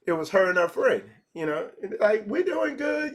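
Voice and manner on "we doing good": lightly voiced woman, pitched up; woman's reply. Women answering the speaker in line, pitched up. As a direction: answer brightly as the friend, higher.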